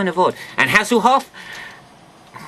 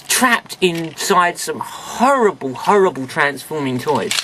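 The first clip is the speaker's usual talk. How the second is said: normal talking voice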